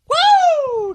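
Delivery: very high, loud whooping cry; excited whoop. Real, not acted. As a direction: let out a wild woo. Excited whoop about Vegas women.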